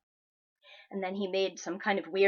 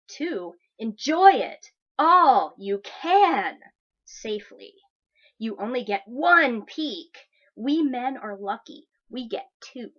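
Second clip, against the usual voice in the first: mocking entitled guy; 7 dB louder than usual. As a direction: ham up a pushy creep's message with heavy emphasis. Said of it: "I'm gonna emphasize the words that he wrote all in caps".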